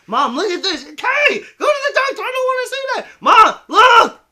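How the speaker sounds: very high, loud shrieking comic voices; comic mom-son bit. Acted. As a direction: ham it up, squealing. Acted mom-son doctor scene.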